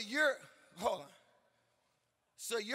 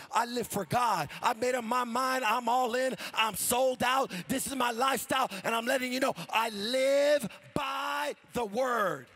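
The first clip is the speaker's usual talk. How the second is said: full-force preaching; 3 semitones higher, 5 dB louder than usual. Real, not acted. Believer declaring a life for God, full-force preaching. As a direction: proclaim each line, stretching the last one out.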